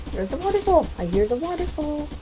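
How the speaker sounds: very high falsetto sing-out; playful squeal. Pretend. Player spotting the waterfall in the game, silly high-pitched squeal.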